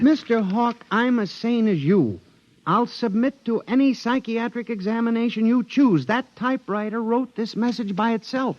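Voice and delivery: high-pitched, urgent insistence; insistent pleading. Acted. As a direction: beg the boss to believe you. Clerk insists to his boss.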